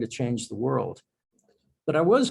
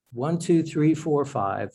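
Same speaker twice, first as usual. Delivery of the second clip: usual speaking voice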